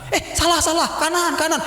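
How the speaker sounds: high, urgent imitation of the wife; flustered correction. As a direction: blurt a hasty correction. Wife corrects doctor, retold.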